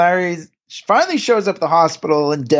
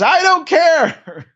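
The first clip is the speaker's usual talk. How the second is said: high, slow mock yell; retold yell; 11 semitones higher, much slower than usual. Banned customer yells back at the café owner, slow high mock yell. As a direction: stretch it out in a high yelled retort.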